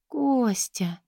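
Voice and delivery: breathy moaned name; moaned whisper. A narrator's reading. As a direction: moan it in a whisper. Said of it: "простонала Катя".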